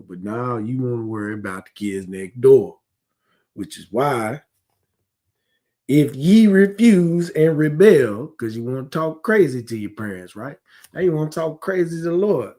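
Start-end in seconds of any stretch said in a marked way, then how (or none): 5.9-8.3 slow, louder weighted reading; solemn scripture quote. Real, not acted. Preacher quoting the verse to his flock, slow and weighty. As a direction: lean on each word of the verse.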